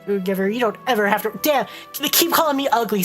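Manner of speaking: ordinary talk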